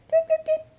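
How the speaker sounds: high little bird tweets, very high. Shown as a demonstration. Teacher recapping the red bird for the kids, high tweets.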